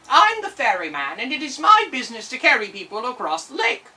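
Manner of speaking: a proud little boatman; tiny ferryman. Acted.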